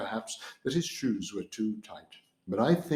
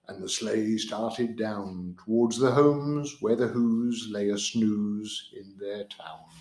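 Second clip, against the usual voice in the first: ordinary delivery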